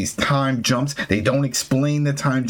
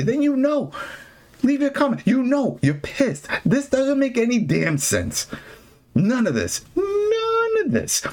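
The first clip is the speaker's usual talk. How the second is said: high-pitched, incredulous frustration; exasperated rant; 13 semitones higher than usual. Real, not acted. Host telling listeners the episode makes no sense, exasperated. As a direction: voice climbing in indignation, stretching the last words.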